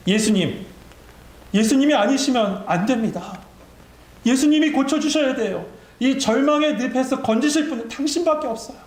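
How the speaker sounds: high-pitched acted plea, climbing in pitch; desperate crying out. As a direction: plead as if there is no other rescuer. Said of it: "비명을 지르고 절규하고 있는 거예요". (높은 음으로 절규하듯 간청)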